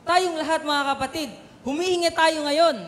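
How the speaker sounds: high and loud, building to a shout on Libre; full-force preaching. Acted. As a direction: preach it hard to the congregation. (malakas at mataas na pangangaral)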